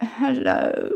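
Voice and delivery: half-hearted, embarrassed mimicry; limp greeting. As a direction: greet as if wanting to vanish. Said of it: "I did a really limp handshake".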